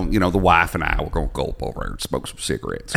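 put-on light, lilting Southern man's accent; effeminate Southern accent. Mocking Southern guys to friends in a light Southern accent. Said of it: "an effeminate Southern accent a little bit".

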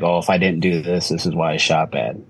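normal talking voice

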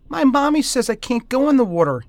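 put-on high, loud child's voice; little boy. A narrator's reading. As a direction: protest like an obedient small child. Child explains to the voice.

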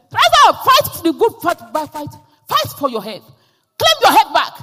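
high, loud battle cry; rallying shout. Retold or imitated. Pastor rallying the woman to fight in faith, loud rallying shout. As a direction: rouse her like a commander into battle.

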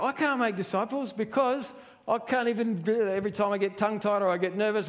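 high, rushed protest; acted flustered objection; excuse-making. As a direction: pile up excuses in a hurry. Acts out believers making excuses to God.

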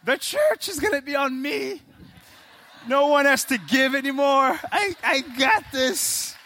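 high excited bargaining voice; gambler's prayer. Retold or imitated. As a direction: promise God the world, shouting with glee. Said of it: "you bought a scratch ticket".

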